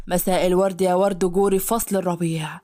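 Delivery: low, smooth male greeting; flirtatious man. Acted. Hadi greets the maid, low and flirtatious. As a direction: charm her at the door.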